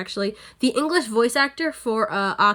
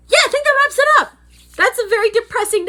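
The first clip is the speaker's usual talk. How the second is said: sudden chirpy outro; 12 semitones higher, 6 dB louder, faster than usual. Real, not acted. Abrupt cheery outro.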